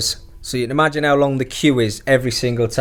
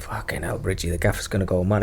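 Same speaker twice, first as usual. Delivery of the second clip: hushed worried; 6 semitones lower, 6 dB quieter than usual. Retold or imitated. Worried aside to teammate.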